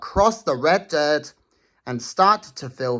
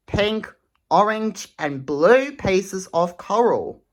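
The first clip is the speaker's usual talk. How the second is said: ordinary delivery, nothing marked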